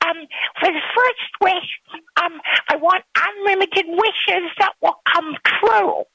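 put-on eager little girl making a wish. A narrator's reading. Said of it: "before responding".

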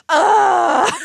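loud, high ugh of frustration; hammed-up frustrated groan; exasperated groan. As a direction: groan like a sulky child. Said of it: "And I just go".

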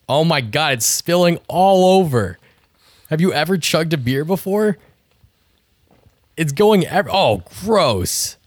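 loud, rising play-by-play; excited commentary. Real, not acted. Reacting to spilled chug.

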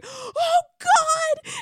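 very high, loud panicked yelp; imitated scream. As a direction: shriek it like you just saw the cup smash. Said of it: "Justin scream".

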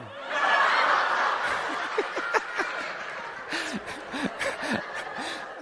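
helpless breathy laughter fading out; long real laugh. Real, not acted. Preacher recalling corn on his forehead, helpless laughter. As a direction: laugh till it runs out.

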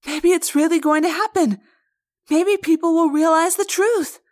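very high and loud joy; girl overjoyed the truth may get out; exultant. Acted. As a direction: burst with disbelieving delight. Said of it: "Cassie exulted".

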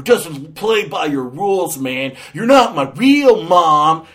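hammed-up teen defiance; angsty teenager. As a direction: whine it like a rebellious teenager. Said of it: "she's an angsty teenager".

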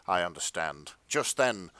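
usual speaking voice

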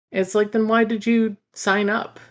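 high, loud incredulous retort; exasperated outburst. Real, not acted. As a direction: throw the question back in disbelief.

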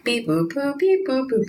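playful robot beeps, rising pitch; beep boop noises. Retold or imitated. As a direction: chirp them like space signals. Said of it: "And so it's like".

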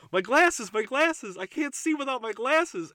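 high, loud panicked Velma cry; high Velma whine. Retold or imitated. Velma squealing over her lost glasses, high and loud. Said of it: "Velma go".

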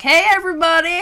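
high, loud cheery hello to the room; mock party greeting. Imitating the host greeting the whole family, loud. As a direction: burst in on the crowd with glee. Mimics family at present opening.

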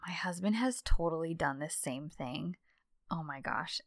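whispered aside. Real, not acted. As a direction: breathe it under your voice. Low aside.

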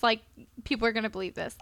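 ordinary talk, nothing marked

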